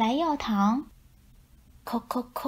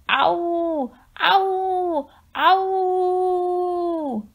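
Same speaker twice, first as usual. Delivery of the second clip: high, long excited howls; wolf dad howling in the forest; 9 semitones higher, 4 dB louder, much slower than usual. A narrator's reading. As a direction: howl like a wolf on the hunt. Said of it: "兴奋的叫声".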